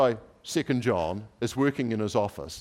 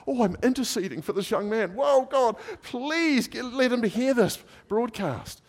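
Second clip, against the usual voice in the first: fervent intercession; 13 semitones higher than usual. Retold or imitated. Man interceding for the young man, high fervent plea. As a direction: call on God at the top of the voice.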